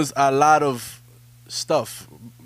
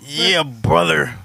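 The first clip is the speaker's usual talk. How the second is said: 1 Hulk Hogan catchphrase; much slower than usual. Retold or imitated.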